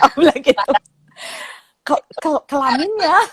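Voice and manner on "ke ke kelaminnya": shocked, stuttered question, pitched up; stammering. Retold or imitated. Mother repeating her child's word in disbelief, stammering. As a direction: trip over the first syllable in mock shock. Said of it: "sampai berebet gitu".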